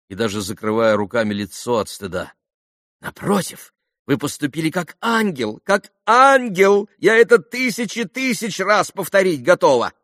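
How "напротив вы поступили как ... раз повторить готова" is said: high, rapturous praise, swelling louder; gushing society mother. A narrator's reading. Khokhlakova reassures Alyosha he acted like an angel, gushing high. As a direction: gush with stretched, emphatic admiration.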